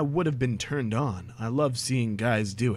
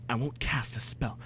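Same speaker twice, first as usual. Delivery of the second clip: ordinary talk, nothing marked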